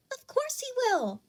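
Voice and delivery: a cheerful schoolgirl backing a friend up; chiming in brightly. A narrator's reading. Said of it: "Araka had chimed in".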